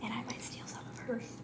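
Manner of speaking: whisper. Real, not acted. Aside whispered to camera.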